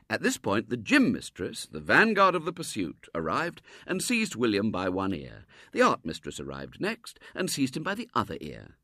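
ordinary delivery, nothing marked

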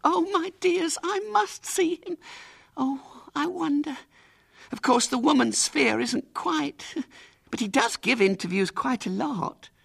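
excited aunt. Acted. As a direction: gush the news to the children. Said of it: "in a state of great excitement".

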